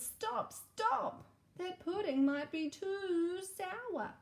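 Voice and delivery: very high, slow shouted warning; high, slow shout of alarm; knight shouting. Acted. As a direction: cry out like a frantic knight. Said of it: "but the knight shouted".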